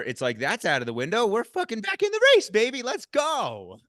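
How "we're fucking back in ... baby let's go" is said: high-pitched, fired-up rallying cry; jubilant, much higher-pitched burst; excited hype. Retold or imitated. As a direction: whoop it up like you're back in contention. Retelling hyped comeback in the race.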